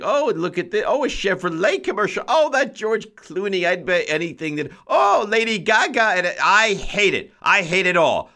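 high, gushing mock voice of an old Long Island woman; old Long Island lady. Host mocking party guests who gush over ads, high put-on old lady voice. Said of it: "What voice is that?".

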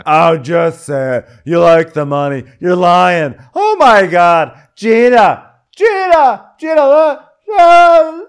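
put-on high, loud, building drunken shout; drunken yelling. Drunk woman taunting, then shouting for her friend. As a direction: escalate into a wail for Gina.

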